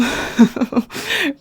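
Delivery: high giggle at herself; embarrassed laugh. Real, not acted. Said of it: "really glamorous backstage".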